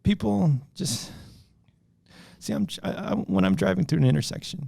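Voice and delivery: mock crying through the words. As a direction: fake tearful rant, voice wobbling. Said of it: "You have an adorable crying voice".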